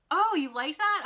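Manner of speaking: high, loud surprised question; retold burst of surprise; pleasantly surprised. Retells idol's surprised reply.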